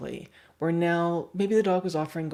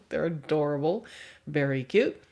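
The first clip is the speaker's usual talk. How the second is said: normal talking voice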